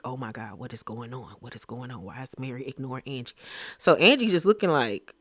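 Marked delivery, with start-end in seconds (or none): 0.0-3.3 very soft and low, almost breathed; hushed whisper. Retold or imitated. The silent table wondering about Mary, hushed. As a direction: murmur it like a nervous dinner guest.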